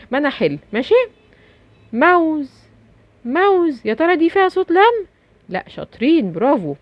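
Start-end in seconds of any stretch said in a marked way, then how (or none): 1.9-5.0 playful quiz. Acted. Quizzing children on a sound.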